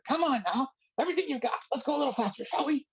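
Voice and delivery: very high, pushy coaching voice; Siddha's voice. Retold or imitated. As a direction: egg them on in a squeaky high voice. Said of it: "but he'd be going".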